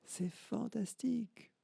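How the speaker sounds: high, soft, slow echo coming back; faint distant echo of the girl's cry. Acted. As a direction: let it sound like a far-off mountain echo. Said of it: "Et elle entend".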